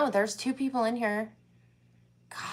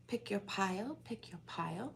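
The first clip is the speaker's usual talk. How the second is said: soft whispered instruction; 14 dB quieter than usual. Real, not acted. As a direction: breathe it out in a whisper.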